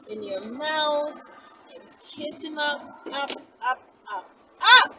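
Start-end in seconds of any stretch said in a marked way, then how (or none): none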